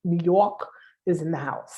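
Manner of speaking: hammed-up Brooklyn "New Yawk is in the house"; Brooklyn accent. Shown as a demonstration. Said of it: "I'm gonna say it with my Brooklyn accent".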